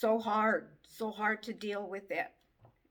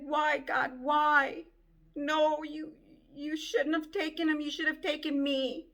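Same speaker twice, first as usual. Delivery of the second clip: raised, pleading shout up high; anguished cry to God; 6 semitones higher, 3 dB louder than usual. Retold or imitated. Grieving sibling crying out to God, raised anguished shout.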